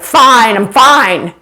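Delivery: loud, punched, irritated "fine"; angry insistence. Acted. Spouse who slammed the door answers, angry insistence. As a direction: insist crossly that nothing is wrong.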